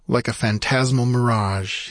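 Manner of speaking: plain reading voice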